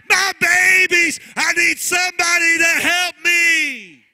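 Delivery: high loud desperate wail; widow crying out. Acted. Preacher voicing the widow crying to the prophet, loud and high. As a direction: cry it out like a widow begging the prophet. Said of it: "what she sounds like".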